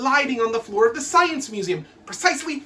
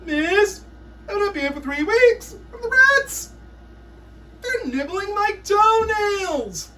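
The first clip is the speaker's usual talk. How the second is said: put-on very high, louder whining child; whiny kid; 10 semitones higher, 4 dB louder than usual. Prisoner pupil begs miss about rats, high wail. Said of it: "kid in school prison".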